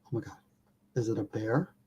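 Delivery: a hushed, frightened whisper; scared whisper. Retold or imitated. Hearing something walk up to his hammock, scared whisper. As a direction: barely breathe the words in fear.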